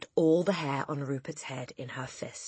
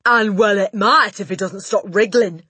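loud, higher-pitched scornful retort; snorting Trunchbull; 5 semitones higher, 13 dB louder than usual. A narrator's reading. Headmistress dismissing the teacher's plea, loud snort. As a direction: snort it back loudly. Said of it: "snorted the Trunchbull".

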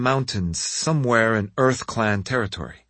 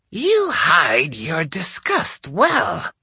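put-on old woman voice; 8 semitones higher, much slower than usual. A narrator's reading. Old lady voice for Hansho.

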